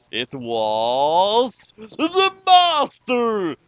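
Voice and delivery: slow, drawn-out big reveal; slow dramatic announcement of the villain. Acted. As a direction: stretch the reveal out slowly. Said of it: "dramatic reveal".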